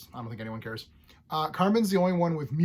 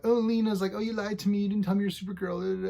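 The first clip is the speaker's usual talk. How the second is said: higher, louder sarcastic mimic of Lena; mocking whiny quote; 6 semitones higher, 3 dB louder than usual. Reviewer mocking Lena's complaint to Supergirl, sarcastic mimic. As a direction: ham up her hurt complaint. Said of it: "it's all like".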